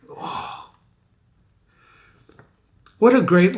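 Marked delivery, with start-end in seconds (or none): none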